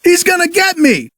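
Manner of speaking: panicked cry. Acted. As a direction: yell it running away scared. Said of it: "he continued to scream".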